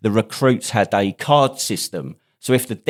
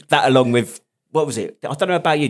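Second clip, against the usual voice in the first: ordinary delivery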